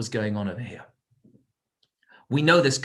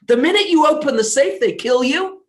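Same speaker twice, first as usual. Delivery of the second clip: much higher and louder, driving it home; dramatic climax; 12 semitones higher, 8 dB louder than usual. Acted. Preacher tells listeners of the deadly trap, loud and high. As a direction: hit the danger hard.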